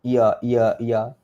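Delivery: "iya" repeated quickly as loose i-ya; wrong single y. Shown as a demonstration. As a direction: toss off each "iya" with no doubled y. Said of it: "Salah lah iya tu".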